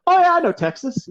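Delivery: high, bright-eyed mock Brit recognizing a place; mock chirpy Brit. American retelling UK trip, mimicking a Brit in a high mock voice. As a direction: pitch it up like a clueless local pretending to know. Imitating Brits answering where from.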